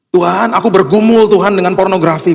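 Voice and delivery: high, wailing cry to God; pleading prayer. Acted. Believer confessing a struggle to God, acted high pleading.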